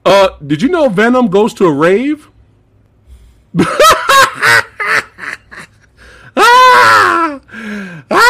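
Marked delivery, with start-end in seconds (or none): 3.5-8.3 high shrieking laughter; hysterical laughter. Real, not acted. Laughing fit at the rave.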